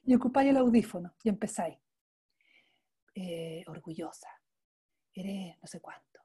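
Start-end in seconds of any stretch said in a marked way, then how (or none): 3.2-6.2 hushed whisper right at the ear. Shown as a demonstration. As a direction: breathe the judgments into their ear. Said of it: "tú le empiezas a susurrar al oído".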